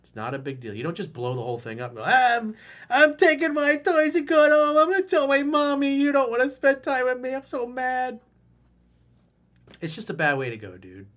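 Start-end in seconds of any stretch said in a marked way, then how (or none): 2.0-8.2 very high, loud childish whining, hammed-up; whiny eight-year-old. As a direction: sulk like a kid leaving with his toys. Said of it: "It's like an eight-year-old".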